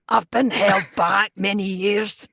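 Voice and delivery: slow, hammed-up wrecked-voice student; Bea Arthur. Joking along with the host as the held-back student, hammed-up impression. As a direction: drag it out as an overgrown student. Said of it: "Bea Arthur, just going".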